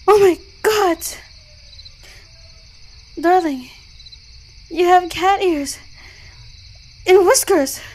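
shocked discovery. Acted. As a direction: exclaim it in stunned disbelief. Discovers partner has become cat.

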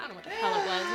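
ordinary delivery

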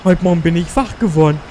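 lightly voiced worried teenager; mock teen letter voice. Reading a teen magazine letter.

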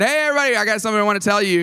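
high, quick call for attention; re-enacted dad's announcement, high and hurried; calling the kids. Calls to kids in the van.

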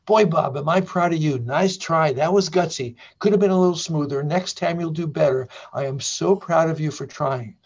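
proud inner voice. Acted. Inner voice consoling Bob after Sally's refusal, proudly encouraging. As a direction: play a proud inner cheerleader, voice lifted. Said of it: "which of these two voices do you think came on".

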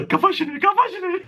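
high-pitched mock panic; nervous panic. Traveller fears the officer has caught him, hammed-up panic. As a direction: squeak it out, pitch climbing on the repeat. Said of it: "أنا بديت أتوتر أكثر".

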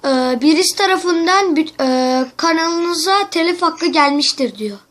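yüksek sesle telaşlı oyunculuk; korkmuş gibi yap, sesini yükselt; panik numarası. Acted. Telefon şakası, panik numarası. (arkadaşına kanalı silindi diye panikle sesleniyor)

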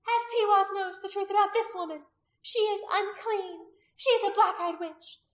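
high, loud denunciation; accusing priestess. A narrator's reading. Priestess denouncing the woman before the men, high and loud. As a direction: condemn her point by point, loudly.

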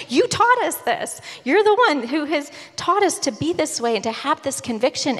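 high, pleading protest as Uriah. Retold or imitated. Uriah confronting David, retold.

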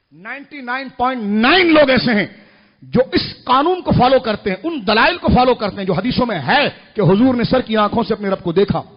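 loud, hammered-out preaching; preaching at full force. Real, not acted. Preacher citing the majority to the crowd, full force. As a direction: build into a forceful declaration.

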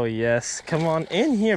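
ordinary delivery

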